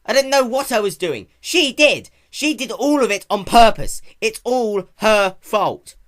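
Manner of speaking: high, loud furious rant; upset young dragon shouting at Rarity; angry shouting. Acted. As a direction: blurt it out in hurt anger. Spike shouting his grievance.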